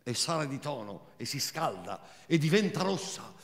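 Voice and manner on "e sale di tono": ordinary talk, nothing marked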